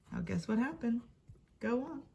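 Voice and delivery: ordinary delivery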